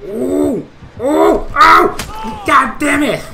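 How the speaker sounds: high, loud yelps of pain; panicked screaming. Real, not acted. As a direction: scream out, caught and hurt. Caught by the killer.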